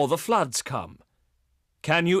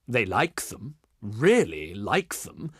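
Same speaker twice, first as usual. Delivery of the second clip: low, drawn-out REALLY; knowing hint; 7 semitones lower than usual. Acted. Envoy hinting his people want gifts, knowing hint. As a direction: lean slowly on "really", pitched low.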